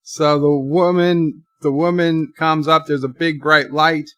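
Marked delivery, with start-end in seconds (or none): none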